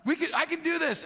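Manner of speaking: high, shouted encouragement; father yelling up, urging his son to jump; dad calling from the water. Retold or imitated. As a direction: call up to the boy on the roof. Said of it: "he was down in the water going".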